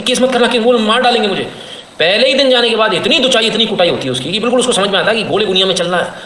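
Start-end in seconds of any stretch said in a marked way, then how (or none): 0.0-1.5 higher-pitched imitation of a scared woman; hammed-up scared begging; mock frightened plea. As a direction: plead as if in fear, exaggerated. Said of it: "तो डर के कहती".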